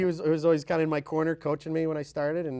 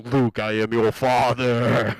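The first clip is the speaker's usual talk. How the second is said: slow, low, loud Vader rumble; Darth Vader voice; 5 semitones lower, 6 dB louder, much slower than usual. Pretend. Dad teasing his son as Darth Vader, slow and low. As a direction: intone it like a movie villain. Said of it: "walk around the house going".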